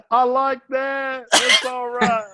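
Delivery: high, slow Southern-politician drawl; Bill Clinton voice. Retold or imitated. Clinton grins back at the storyteller's bold joke, in his slow high drawl. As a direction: savor it the way Clinton would, amused and approving. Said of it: "he slaps me on the shoulder and he goes".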